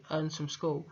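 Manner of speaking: ordinary delivery, nothing marked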